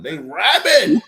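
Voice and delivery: high, loud punchline; comic exclamation. Real, not acted. Joking with friends about drooling dogs, high loud insistence. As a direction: raise voice and pitch to insist.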